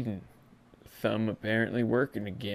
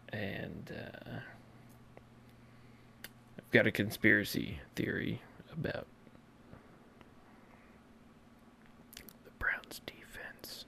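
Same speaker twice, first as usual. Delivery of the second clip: much softer, breathy whisper; conspiratorial whisper; 13 dB quieter than usual. Acted. Host to fans, whispering a conspiracy theory. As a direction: hush it like a secret.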